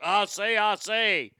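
blustery mock-Southern cartoon voice; hammed-up Southern bluster; Foghorn Leghorn. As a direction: parody a folksy Southern cartoon rooster. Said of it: "a fucking Foghorn Leghorn".

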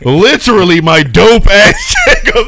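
high, loud, laughing excitedly; laughing shout. Real, not acted. Laughing fan shout about her.

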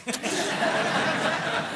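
breathy burst of real laughter; laughing at a shared glance with Jill. Real, not acted. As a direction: crack up, caught off guard. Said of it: "I shouldn't have looked at Jill".

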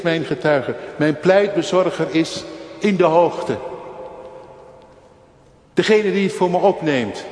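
luid en hoog preken, vol vuur; vurige preek. Acted. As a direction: preek het uit met volle overtuiging. Preekt met volle kracht. (vurig preken, luid en hoog)

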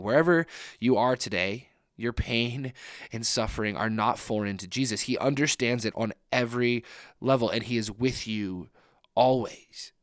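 usual speaking voice

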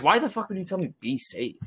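high, disbelieving mock-indignant retort; incredulous at a stranger's pointless warning. Retelling his reply to the worker, incredulous.

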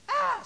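high, very loud imitated wail; mock holler. Preacher imitating daughter at testimony meeting, hollering. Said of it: "she hollered".

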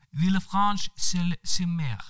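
Villefranche-sur-Mer said slowly, high, in careful French; careful French name. Acted. Monica determined to say Villefranche-sur-Mer right, slow careful French. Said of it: "fast bestemt på å uttale navnet riktig".

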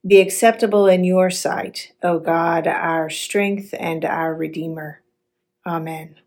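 normal talking voice

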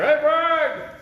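high, loud call across the dark; yelled name. Retold or imitated. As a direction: shout his name from far off. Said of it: "He's yelling at me".

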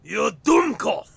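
slow, loud mock German insult; mock German accent. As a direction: spit out the insult, slowly. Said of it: "No, wait, that's wrong".

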